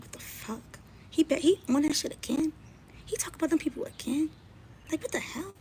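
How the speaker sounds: imitating daughters rolling their eyes; mock daughters. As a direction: play the fed-up kids, half whispered. Voicing his daughters.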